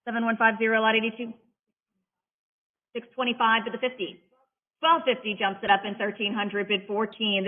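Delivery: brisk rolling auction chant of numbers; auctioneer bid calling. Acted. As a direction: call the bids like a live auctioneer.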